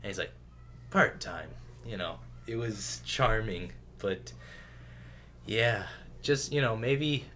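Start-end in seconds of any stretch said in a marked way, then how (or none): 0.9-1.5 low, understated quip; underplayed hero aside; better trailer take. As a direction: toss it off lower, without pushing. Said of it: "it was a way better take".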